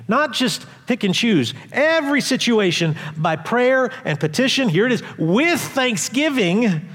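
high, forceful preaching of the verse. Acted. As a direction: preach it out, rising with conviction. Preaches the passage at full force.

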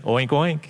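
two plainly spoken oinks; understated, deadpan pig oinking. Acted. Pig agreeing life is good, mild oinking. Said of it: "And the pig goes".

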